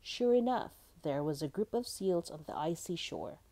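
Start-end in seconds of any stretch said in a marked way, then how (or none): none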